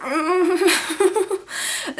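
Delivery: breaking into laughter mid-sentence; laughing. Real, not acted. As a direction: laugh through the words. Laughing with locked jaw.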